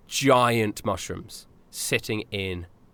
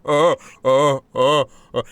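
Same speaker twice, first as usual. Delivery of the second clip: booming giant laughter; 3 semitones higher, 7 dB louder than usual. Acted. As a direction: laugh like a giant shaking the room. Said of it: "The whole room shakes with his laughter".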